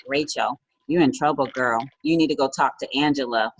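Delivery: low, soft mock producer voice; lightly imitating a deep producer; bad impression. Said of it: "since we're doing impressions with Rachel".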